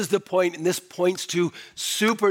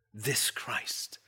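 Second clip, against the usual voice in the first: whispered reverently; soft reverent whisper; 6 semitones lower, 11 dB quieter than usual. Real, not acted. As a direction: breathe the name out in a whisper. Whispered climax.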